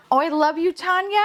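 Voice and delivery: high, loving squeal; high-pitched doting cry; adoring gush. Real, not acted. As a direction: coo it high to a beloved toy. Cooing to her new toy.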